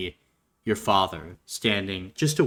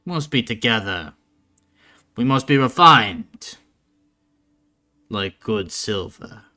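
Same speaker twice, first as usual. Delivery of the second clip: stern speech building to loud insistence; rally the family with weighty, emphatic phrases; gruff father; 5 dB louder than usual. Acted. Father readies child for family business, gruff and exhorting. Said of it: "you would hear a gruff voice go".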